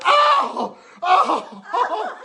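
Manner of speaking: very high, loud hammed-up oh of pain; mock pained cries. Drunk man cries out as the leprechaun gets him. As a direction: wail it out, laughing through it.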